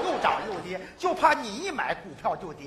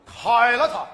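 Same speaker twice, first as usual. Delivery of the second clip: loud punchline; 8 dB louder, slower than usual. Acted. Punchline, firing the boss.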